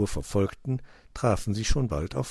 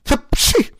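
very high, loud "hapschi" sneeze; acted sneeze; 12 dB louder than usual. A narrator's reading. Cat smelling fox, explosive sneeze. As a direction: burst into a storybook sneeze. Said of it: "nieste er".

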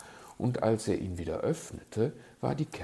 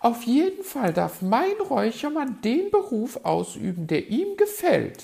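high, loud bird lady; high-pitched stubborn magpie; magpie, defiant; 11 dB louder than usual. A narrator's reading. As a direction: insist in a piping put-on voice.

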